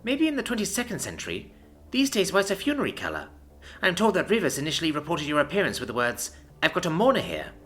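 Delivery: mocking. A narrator's reading. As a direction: sneer at the outdated idea. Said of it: "she mocks".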